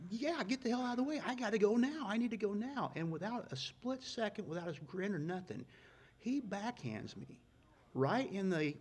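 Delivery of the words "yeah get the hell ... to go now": higher, louder, frantic retold yell; impatient outburst. Young racer snapping at Leonard to move, impatient yell. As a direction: blurt it out like you're late and cornered.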